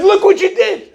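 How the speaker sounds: very high, strained blaming cry; furious accusation. Retold or imitated. Car owner accuses the rabbi, high and furious. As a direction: blame him loudly for the damage. Angry stranger blames the rabbi.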